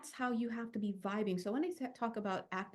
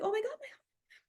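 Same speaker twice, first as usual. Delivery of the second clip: much higher, amazed; astonished gasp; 12 semitones higher than usual. Retold or imitated. Seeing her own heartbeat through the skin, astonished. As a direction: gasp it out in shock. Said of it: "I was like".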